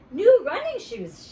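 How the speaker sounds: high, slow, loud show-off; girl boasting about new shoes; proud girl voice. Acted. Retake of Grace line.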